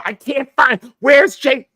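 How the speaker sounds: high, loud phone shouting; imitated frantic yelling. Lost friend yells into phone beside host, imitated shouting. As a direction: yell it high like someone lost.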